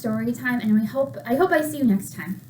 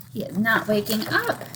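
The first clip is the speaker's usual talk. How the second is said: usual speaking voice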